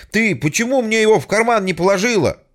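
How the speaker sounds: loud reproach. Acted. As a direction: shout the complaint. Hero scolding wife by phone. (громко, с упрёком)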